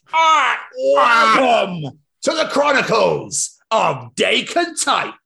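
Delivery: high, slow booming announcer voice; Gord P. Boyd voice. Acted. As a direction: belt it out high and slow like a grand host. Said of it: "make him sound like Gord P. Boyd".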